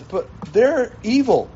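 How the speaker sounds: high-pitched, protesting objection; acted-out dismayed protest; Habakkuk. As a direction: object like you can't believe it. Habakkuk objects to God's plan.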